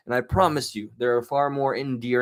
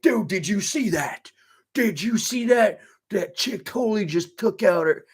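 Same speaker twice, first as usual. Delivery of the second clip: high, excited phone gush; excited dude; 9 semitones higher than usual. Retold or imitated. Guy gushing to his brother on the phone, high and excited. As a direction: gush like a buddy who just saw something wild.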